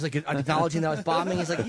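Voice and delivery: usual speaking voice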